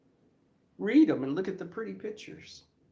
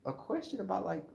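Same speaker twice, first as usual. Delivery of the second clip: rising to a much higher, baffled squeal; baffled inner voice climbing far higher; incredulous inner thought; 7 semitones higher, 3 dB quieter than usual. Retold or imitated. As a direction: build from a soft, higher mutter to a shrill, disbelieving squeak. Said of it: "I'm thinking".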